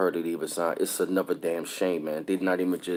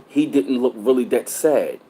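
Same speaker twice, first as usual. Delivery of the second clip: rising indignation; 5 semitones higher, 7 dB louder than usual. Real, not acted. Indignant claim about the neighbor.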